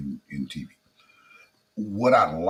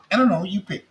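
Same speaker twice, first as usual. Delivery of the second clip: higher, imitating the wife's shrug; voicing his wife; 10 semitones higher, faster than usual. Husband imitates his wife deflecting the choice, higher voice. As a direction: mimic his wife's "I don't know, you pick".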